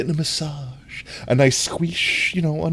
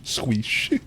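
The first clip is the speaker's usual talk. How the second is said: ordinary delivery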